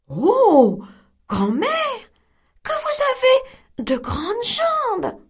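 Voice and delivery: put-on girl staring at granny in bed; wondering little girl. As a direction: marvel like a puzzled little girl. Girl astonished.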